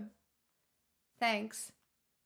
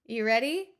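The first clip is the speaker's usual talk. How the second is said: ordinary talk, nothing marked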